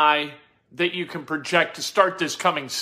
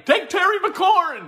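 high, loud retold yell at the TV; 14 semitones higher, 4 dB louder than usual. Host retelling how they yelled during the draft, yelling. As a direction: yell it out, pitch shooting up. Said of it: "I'm yelling".

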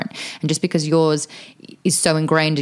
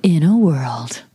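slow, low epic trailer announcer; movie trailer voice; 3 semitones lower, much slower than usual. Shown as a demonstration. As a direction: boom it out slowly like a trailer narrator. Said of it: "Which sounds like what?".

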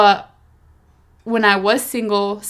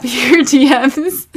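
words broken by laughter; laughing; 4 semitones higher, 7 dB louder, much slower than usual. Real, not acted. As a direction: laugh through the words, slowly. Laughs while saying it.

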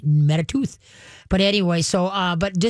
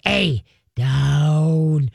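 low growled "a, down"; 3 semitones lower than usual. Shown as a demonstration. Trainer repeating the growled down command. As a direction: growl down firmly. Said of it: "Give 'em a growl".

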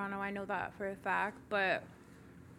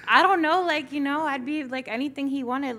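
excited gushing; 5 semitones higher, 9 dB louder than usual. Real, not acted. Laughing, gushing about [name].

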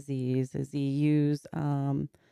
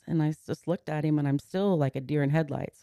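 ordinary talk, nothing marked

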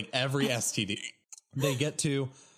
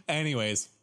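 ordinary talk, nothing marked

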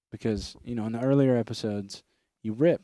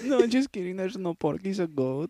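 high vendor voice; 6 semitones higher than usual. Retold or imitated. Joins in the vendor voice.

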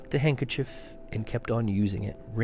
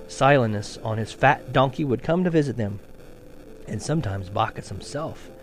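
ordinary delivery

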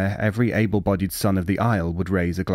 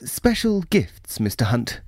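nervous official; 4 semitones higher than usual. Acted. Chambers voiced, nervous official.

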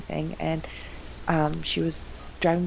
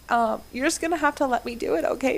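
much higher, tearful but reassuring; mock tearful plea to her husband; teary warning; 10 semitones higher than usual. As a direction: replay holding back tears for her husband. Warning husband she'll cry.

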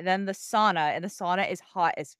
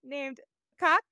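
high, laughing squeal; can barely get the words out for laughing; laughing fit; 11 semitones higher than usual. Real, not acted. Reading a cat article to co-hosts, high-pitched and cracking up. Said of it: "I'm gonna start crying".

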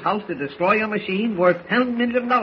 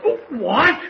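high-pitched, indignant exclamation; outraged outburst; 8 semitones higher, 3 dB louder than usual. Acted. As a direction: explode in raised-voice disbelief. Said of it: "Please lower your voice".

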